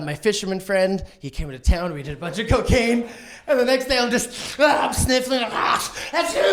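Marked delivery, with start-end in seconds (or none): none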